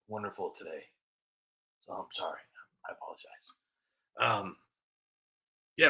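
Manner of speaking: ordinary talk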